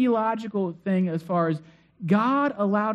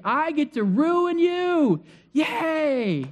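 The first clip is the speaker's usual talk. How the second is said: gloating revenge; 7 semitones higher than usual. Pretend. Preacher voices a vindictive Joseph.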